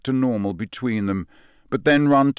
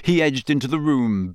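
ordinary delivery

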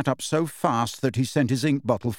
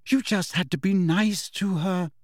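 mildly anxious, higher-pitched; a touch of worry, voice lifted; 6 semitones higher than usual. A narrator's reading. Hermione checking that Harry was nice to her, mildly anxious. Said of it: "looking up anxiously".

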